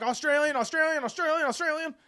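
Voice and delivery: high, loud, hurried repetition; frantic excuse. Retold or imitated. Explaining roommate to the girl, high frantic mock excuse. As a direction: repeat it as a panicked excuse.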